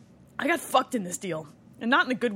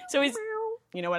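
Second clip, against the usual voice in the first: normal talking voice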